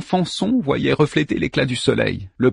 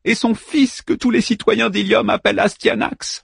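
crying and laughing; 9 semitones higher than usual. Acted. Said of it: "qui pleurait et riait tout à la fois".

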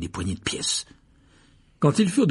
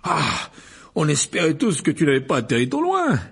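higher, louder relieved exclamation; out of breath; 6 semitones higher, 4 dB louder than usual. A narrator's reading. Mr Weasley has just run to Harry, breathless. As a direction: exclaim while catching your breath. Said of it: "s'exclama Monsieur Weasley hors d'haleine".

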